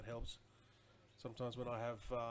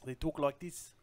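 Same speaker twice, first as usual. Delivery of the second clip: louder imitation South African accent; mock South African accent; 3 semitones higher, 7 dB louder than usual. Streamer admiring how South Africans talk, in an imitated South African accent. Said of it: "I love the South African vo-, um, accent".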